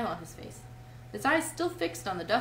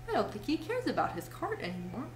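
ordinary delivery, nothing marked

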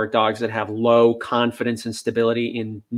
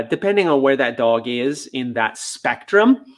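ordinary talk

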